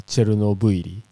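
Chernobyl as "Cherunobuiri", ending in buiri; Japanese pronunciation. Shown as a demonstration. Co-host supplying the Japanese name, Chernobyl ending in "buiri". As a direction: say Chernobyl with Japanese syllables, "Che-ru-no-bui-ri". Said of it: "no se utiliza el buiri".

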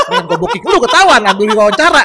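loud, high, laughing protest; mock-outraged retort. Guest retells haggling with a prospective interviewee, loud laughing outrage. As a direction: blurt it out in amused mock outrage.

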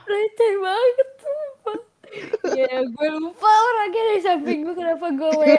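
high, loud, laughing through words; can barely talk for laughing; laughing hard. Real, not acted. Laughing at own blunder.

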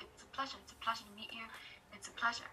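hushed, half-whispered reassurance; soft retold reply. Celebrity answering a moved fan, imitated softly. Retelling a star's tender reply.

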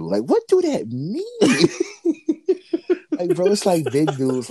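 incredulous. Real, not acted. Mock outrage at the compliment.

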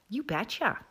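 put-on playful Minnesota-style accent; Midwestern accent. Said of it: "The Midwestern accent".

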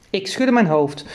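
ordinary delivery

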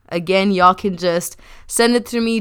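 ordinary talk

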